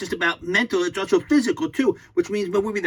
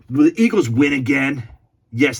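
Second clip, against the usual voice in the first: ordinary delivery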